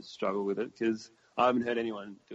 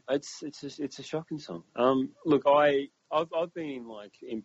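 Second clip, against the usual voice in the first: ordinary delivery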